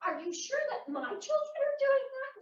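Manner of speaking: high, incredulous mother's question; retold skeptical pushback; doubtful questioning. Mother doubting neighbor's accusation.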